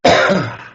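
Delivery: sputtering cough; choking on a sip of water. Real, not acted. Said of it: "the water went down the wrong way".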